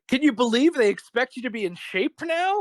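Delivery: high, incredulous complaint; lightly voiced indignant friend; a hint of an older man's gripe; mock Rob Reiner rant. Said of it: "he's like".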